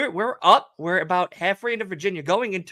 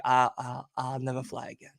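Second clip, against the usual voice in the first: low, soft, shaken mumble; shaken murmur; 7 semitones lower, 8 dB quieter, faster than usual. Retold or imitated. Storyteller in the airport restroom, low soft shaken vow. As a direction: mutter it to yourself, drained. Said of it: "I was like".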